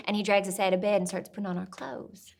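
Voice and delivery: ordinary talk, nothing marked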